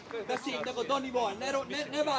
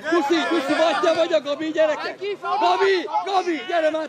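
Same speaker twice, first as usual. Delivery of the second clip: loud, high calls to players; urgent shout; 4 semitones higher, 8 dB louder than usual. Real, not acted. Coach summoning Gabi, shouting loudly. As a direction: holler names across the pitch.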